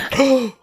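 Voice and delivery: high startled gasp; sharp mock intake of breath. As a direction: gasp in shock. Reader acts being startled.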